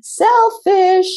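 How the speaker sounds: "selfish" thrown out high and loud; emphatic selfish. Real, not acted. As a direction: punch "selfish" with high pitch. Naming the dreaded word.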